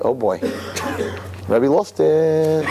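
giggling, slow mocking drawl on "Rebbe lost it"; laughing at the Rebbe. The kid laughing off his Rebbe's lesson, slowly. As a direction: laugh through it, amused and dismissive.

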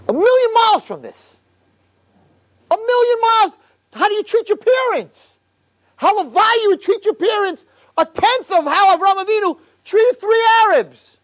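high, ringing rebuke; preaching cry. Real, not acted. Preacher rebuking boys over how they treat parents. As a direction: call them out from the pulpit.

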